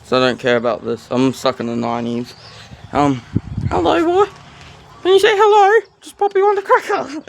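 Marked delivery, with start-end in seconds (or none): 3.7-7.2 very high, louder cartoon parrot voice; squeaky parrot voice. Voicing the bird back to himself: high parrot squawk.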